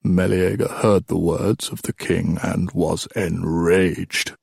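put-on slow, weighty old storyteller; old king storytelling. Old king telling of Meleager's rage, slow storytelling. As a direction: draw the legend out gravely.

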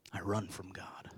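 hushed, breathless whisper; whispered confession. Acted. Preacher admitting his own flight from God, whispered. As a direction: drop to a whisper as if ashamed.

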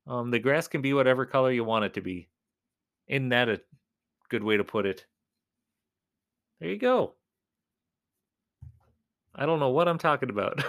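usual speaking voice

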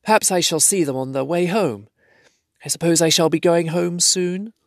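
a hint of sleepiness, lifted up; sleepy hobbit voice. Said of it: "said Bilbo sleepily".

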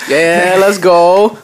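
slow, loud hype call. Real, not acted. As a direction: cheer the crew on. Hyping up co-host.

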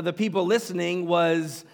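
ordinary delivery

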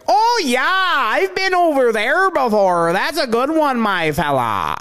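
high, loud, drawn-out Canadian caricature; northern Canadian accent. Retold or imitated. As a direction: ham up a northern Canadian girl. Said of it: "super northern Canada. When the girl is like".

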